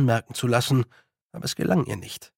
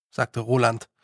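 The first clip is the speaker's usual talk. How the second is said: usual speaking voice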